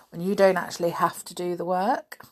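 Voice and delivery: plain reading voice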